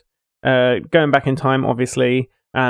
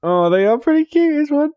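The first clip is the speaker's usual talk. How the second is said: cooing; 13 semitones higher than usual. Real, not acted. Cooing over cute game boxes.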